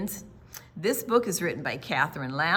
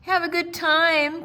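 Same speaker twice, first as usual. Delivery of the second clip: higher, louder call of goodbye; 9 semitones higher, 8 dB louder than usual. A narrator's reading. Mother calling goodbye to the campers. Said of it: "called their mother".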